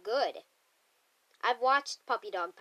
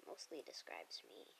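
barely voiced, very soft self-jab; quiet whispered aside about himself; whispered admission; 23 dB quieter than usual. Real, not acted. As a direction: whisper it like a guilty confession. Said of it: "unvoiced whisper".

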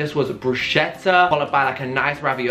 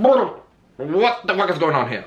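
baffled; 3 semitones higher, slower than usual. Real, not acted. Baffled by baby prop.

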